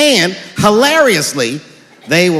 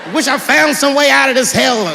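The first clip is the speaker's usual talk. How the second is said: desperate high rant; 7 semitones higher than usual. Retold or imitated.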